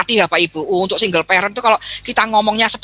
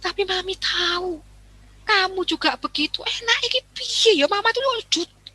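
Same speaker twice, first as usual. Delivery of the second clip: assertive, gentle; 5 semitones higher, 3 dB quieter than usual. Shown as a demonstration. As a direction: tell your child you're tired, tenderly. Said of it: "itu asertif".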